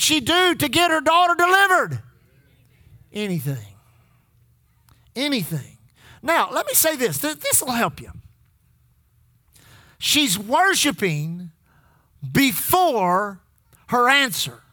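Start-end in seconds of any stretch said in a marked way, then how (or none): 3.1-5.8 hushed answer. Acted. Answer dropped after question. 10.0-14.6 slow, with pauses between the words; she's worshiping, pause, before, pause, her answer; slow spaced phrasing. Shown as a demonstration. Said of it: "let me try that again".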